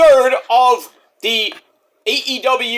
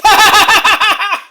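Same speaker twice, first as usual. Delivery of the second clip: high, loud cackling burst; a long run of high ha-has; loud laugh; 13 semitones higher, 8 dB louder than usual. Real, not acted. Host laughing off criticism of his impression, loud high cackle.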